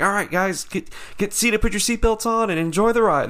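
high-pitched mimicked safety spiel; ride attendant. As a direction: imitate a chipper attendant hustling riders into seats. Ride worker gives boarding orders.

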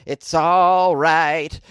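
usual speaking voice

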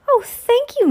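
very high, loud gush; flattered delight. A narrator's reading. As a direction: gush thanks in a squeaky put-on voice. Nero flattered by a gift.

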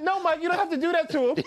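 laughing through a calm plea; laughing quote. Retold or imitated. Camera guy calming Mike, retold laughing. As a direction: plead calmly while cracking up. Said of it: "he said".

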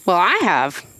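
talking around a candy; words round a crunchy mint; mouth full. Real, not acted. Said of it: "I'm eating the rest of my Lifesaver".

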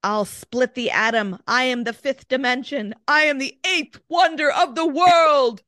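rising mock grandeur, ending loud and high; hammed-up scream of a boastful spirit; retold scream. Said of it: "it screamed at them".

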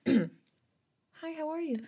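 chirpy timid shop-counter hello; customer service voice. Shown as a demonstration. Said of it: "my customer service voice is so pathetic".

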